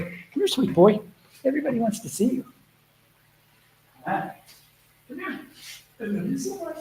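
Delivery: sweet high dog-talk, hushed. Real, not acted. Cooing to her rescue dog.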